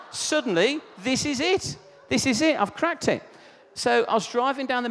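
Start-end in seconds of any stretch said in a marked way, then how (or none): 0.1-3.2 high-pitched, excited realisation; mock triumphant excitement, pitched up; acted eureka. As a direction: burst out as if you've cracked it. Acting out his sudden bright idea.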